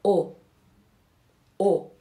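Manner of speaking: او as one rounded "o"; isolated او, the undotted Turkish O; Turkish O demo. Shown as a demonstration. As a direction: sound out او by itself as a pure back "o".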